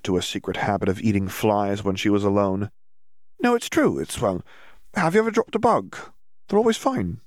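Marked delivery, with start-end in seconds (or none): 3.4-6.0 high, eager insisting; earnest insistence. Acted. Fleur insisting. 6.5-7.2 put-on dreamy girl. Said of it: "Hermione said".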